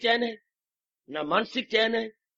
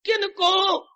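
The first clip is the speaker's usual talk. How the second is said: high, loud challenge to the crowd; sudden preaching shout; rhetorical shout; 10 semitones higher, 4 dB louder, much slower than usual. Real, not acted. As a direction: fling the question out.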